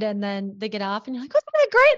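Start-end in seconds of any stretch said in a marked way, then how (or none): 1.3-2.0 excited parent. Retold or imitated. Excited parent asks kid after ride.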